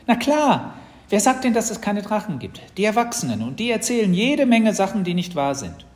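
put-on high, loud, emphatic child; cocksure little sister. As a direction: argue it with total conviction. Sister argues dragons exist.

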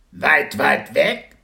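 a little dragon marvelling at the distance; drawn-out wondering creature question; amazed baby dragon. Acted. Dragon echoes the word, amazed.

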